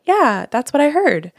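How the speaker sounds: high, loud, breezy fake agreement; mock-confident medium claiming credit; smug parroting medium. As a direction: brush it off as if you knew all along. Medium just parroting the client.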